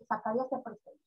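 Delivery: ordinary delivery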